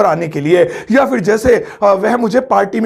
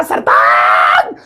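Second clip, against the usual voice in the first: calling out a name; 11 semitones lower, much slower than usual. Retold or imitated. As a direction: shout the name to get his attention. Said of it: "ऐसे चिल्लाया".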